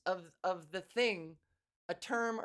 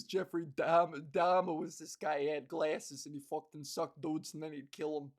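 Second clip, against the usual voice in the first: put-on lowish New York accent after a laugh; 4 semitones lower than usual. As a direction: pick the New York storyteller back up. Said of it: "somebody with that accent, just talking loudly".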